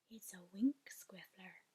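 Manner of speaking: excited hushed whisper; breathless, delighted whisper; thrilled whisper. Acted. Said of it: "he whispered, with a thrill in his voice".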